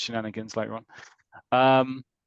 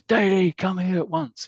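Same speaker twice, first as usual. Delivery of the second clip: much higher and louder barked command; prefect's shout; 9 semitones higher, 7 dB louder, slower than usual. Retold or imitated. Prefect Davis calls Daly over in the dormitory, shouting. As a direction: summon the boy sharply across the dormitory. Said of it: "And he goes".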